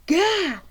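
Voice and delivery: short high "Gah!" of shock at the reflection; startled yell. Acted. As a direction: yelp it out, startled. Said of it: "I yelled in my wolf form".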